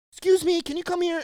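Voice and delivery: high-pitched imitated customer's call; calling him back. Lady calling the employee back, high-pitched mock call. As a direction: call out high to get someone's attention. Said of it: "calls him back".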